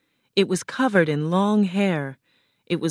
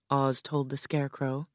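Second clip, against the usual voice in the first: usual speaking voice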